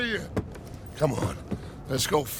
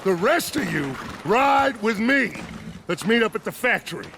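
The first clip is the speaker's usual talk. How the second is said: ordinary delivery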